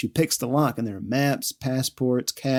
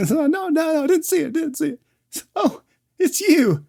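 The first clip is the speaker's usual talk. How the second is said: panicked pleading; 11 semitones higher than usual. Retold or imitated. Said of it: "and he's like".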